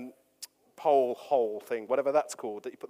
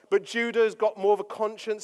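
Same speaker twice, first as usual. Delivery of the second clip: ordinary delivery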